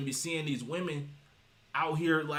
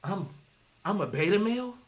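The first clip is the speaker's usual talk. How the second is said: imitating a stunned realization, halting then rushing higher; mock disbelief; 4 semitones higher than usual. Beta males realizing what they are, in mock disbelief. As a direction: play it dawning on him, a rising, incredulous question. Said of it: "The beta males be like".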